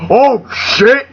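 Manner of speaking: high, hammed-up startle; mock alarm. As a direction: exclaim like a spooked crowd. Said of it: "The same people are like".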